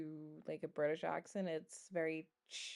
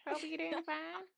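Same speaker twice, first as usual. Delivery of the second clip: friendly Texan; 10 semitones higher than usual. Shown as a demonstration. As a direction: greet like a friendly Texas neighbor. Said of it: "Do Texas accent".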